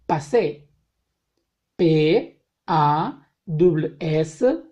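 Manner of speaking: slow, letter-by-letter French spelling; spelling aloud. Shown as a demonstration. Teacher spelling French words for students, slow and clear. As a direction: spell each word out slowly for the class.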